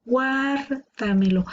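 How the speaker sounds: "Guárdamelo" slowly, long GUAR then a lighter damelo; stress on GUAR. Shown as a demonstration. Teacher confirming the tonic syllable, drawn-out GUAR in "Guárdamelo". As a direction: stretch GUAR, run "damelo" together after it. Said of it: "La sílaba tónica está aquí".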